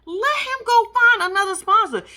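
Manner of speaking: high-pitched exasperated rant. Real, not acted. Vlogger ranting at Eve about her man, high and exasperated. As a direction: throw up your hands at her.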